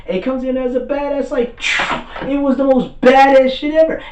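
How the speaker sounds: high, loud, thrilled retelling; near-yelling with fan excitement; hyped-up fan. Real, not acted. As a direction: gush over the coolest entrance ever. Excited retelling continues after apology.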